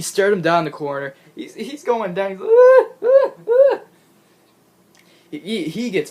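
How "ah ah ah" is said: high, loud panicked mock cries; mock scream. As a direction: yelp like a jobber about to get thrown. Jobber screams being tossed.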